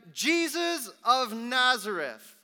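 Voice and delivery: loud, high, slow proclamation; proclaimed inscription. A narrator's reading. Inscription put on the cross, loud proclamation. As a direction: ring it out like a herald.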